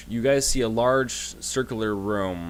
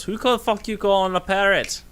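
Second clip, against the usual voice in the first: high, loud indignant snap; a tiny dwarf taking huge offence; offended dwarf; 12 semitones higher, 5 dB louder than usual. Acted. Dwarf snapping at the player who called him a parrot, indignant. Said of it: "He just looks at you and goes".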